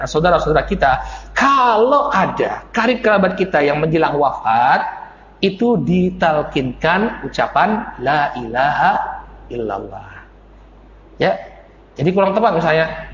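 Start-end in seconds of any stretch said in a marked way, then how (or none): none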